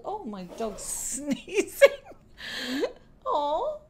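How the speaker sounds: slow words through laughter; laughing. Real, not acted. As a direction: laugh while fussing over the dog. Dog sneezes during recording.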